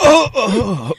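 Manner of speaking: high, loud startled oh oh oh; father crying out as his wife snatches his phone. Acted. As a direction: yelp as the phone is grabbed away.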